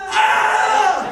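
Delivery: high yelp before throwing up; mock retching cry. Gym client yelping before throwing up, high cry. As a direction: cry out like you're about to vomit. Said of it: "And I went".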